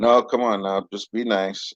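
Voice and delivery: ordinary talk, nothing marked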